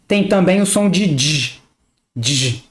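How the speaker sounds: d said as "dji"; dj sound. Shown as a demonstration. Teacher showing the dji sound of D plus I. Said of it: "D mais I".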